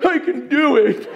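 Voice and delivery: high, choked-up imitation with a laugh; grown men moved. Grown men stirred by a puppet, mock emotional. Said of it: "Grown men are going".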